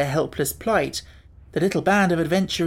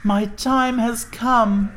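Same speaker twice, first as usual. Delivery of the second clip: very slow, higher despairing moan; pumpkin head voice; 7 semitones higher, 3 dB louder, much slower than usual. A narrator's reading. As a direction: moan out your doom.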